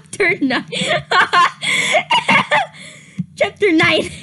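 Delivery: real laughter through the words; giggling chapter announcement; laughing. Real, not acted. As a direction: try to announce it but keep cracking up. Cracking up at own story.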